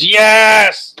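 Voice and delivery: huge, high-pitched bellowed yes; really loud. Acted. Player showing his Viking-like character's catchphrase, really loud. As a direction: roar it like a battle-crazed brawler. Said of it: "really loud and shit like that".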